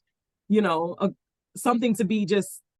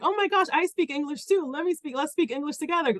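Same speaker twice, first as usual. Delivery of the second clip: high, eager and thrilled; excited Italian woman; 8 semitones higher than usual. Retold or imitated. Imitating the Italian woman's excited gush. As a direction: gush like a delighted stranger. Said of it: "she gets really excited".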